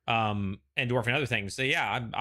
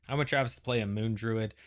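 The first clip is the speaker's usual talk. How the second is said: ordinary talk, nothing marked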